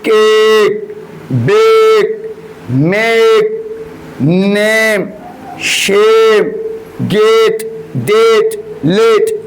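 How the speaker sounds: each word drawn out clearly for the class; teacher modelling words. Shown as a demonstration. Teacher reads example words aloud to class, carefully articulated. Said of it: "ए का sound करेंगे".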